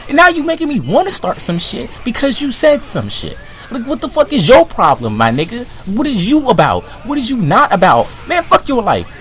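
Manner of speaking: high-pitched indignant rant; getting heated. Real, not acted. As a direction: lose your cool at someone who provoked you. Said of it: "I'm getting too heated".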